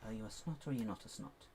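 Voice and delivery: ordinary talk, nothing marked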